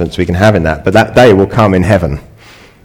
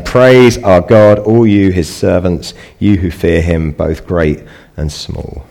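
proclaiming slowly; slower than usual. Retold or imitated. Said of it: "a voice came from the throne saying".

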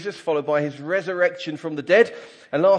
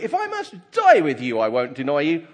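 emphatic vow; 2 semitones higher, 5 dB louder than usual. Acted. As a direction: protest it emphatically, voice raised. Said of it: "he said emphatically".

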